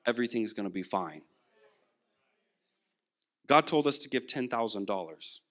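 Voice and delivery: ordinary talk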